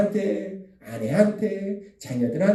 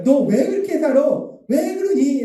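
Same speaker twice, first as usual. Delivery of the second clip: higher-pitched acted reproach; scolding; 6 semitones higher, 3 dB louder than usual. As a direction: act out telling someone off, pitched up. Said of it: "혼내키시는 것이 아니라". (혼내듯 높은 목소리로 다그치기)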